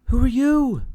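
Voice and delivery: high, loud startled question; a father pretending to be scared. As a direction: ask it still jumpy from the fright.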